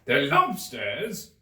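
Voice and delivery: loud, high shout. A narrator's reading. The Gryphon shouts over the Mock Turtle, loud. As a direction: shout it out eagerly. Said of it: "shouted the Gryphon".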